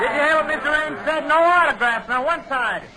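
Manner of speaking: loud, high call over the crowd; shouting to crowd. Acted. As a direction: bellow it to the fans. Clearing crowd at ballpark.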